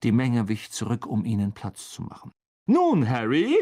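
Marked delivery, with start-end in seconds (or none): 2.7-3.6 loud, higher, hearty address; showy teacher. A narrator's reading. Teacher turning to his pupil, loud and showy. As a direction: launch grandly into the lesson.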